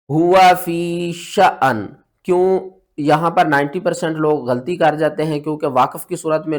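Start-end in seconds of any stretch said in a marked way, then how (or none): 0.1-1.9 slow drawn recitation of शअन; Quran recitation. Shown as a demonstration. Teacher recites the verse ending for students. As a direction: recite it slowly, holding the vowels. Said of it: "तो सही पढ़ने का तरीका क्या है?".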